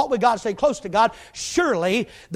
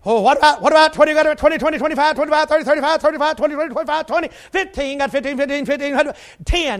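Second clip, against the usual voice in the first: fast auctioneer patter, higher; 4 semitones higher, faster than usual. Acted. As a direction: rattle off numbers like an auctioneer. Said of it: "Sound like a reverse auctioneer".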